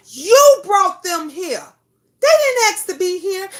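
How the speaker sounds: emotional shout. Real, not acted. Vlogger pleading with parents about their duty, tearful shout. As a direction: cry it out as a plea to parents.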